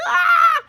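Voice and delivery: loud, very high mock scream; hammed-up falsetto shriek of panic; freaking-out scream. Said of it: "they're all freaking out".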